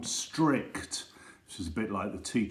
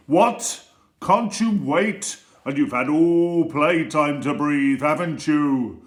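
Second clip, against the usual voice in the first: loud, high, indignant snap; strict teacher; 4 semitones higher, 9 dB louder, slower than usual. Acted. Strict teacher scolding the pupil who asks to breathe, loud and indignant. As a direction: scold him for even asking. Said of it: "And she'd say".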